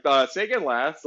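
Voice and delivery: mock radio operator asking for a repeat; hesitant radio-speak request. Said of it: "they'll be like".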